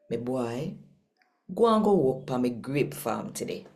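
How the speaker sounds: ordinary talk, nothing marked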